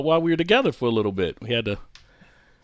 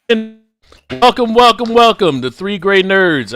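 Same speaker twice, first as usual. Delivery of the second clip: show host intro; 8 semitones higher, 5 dB louder than usual. Acted.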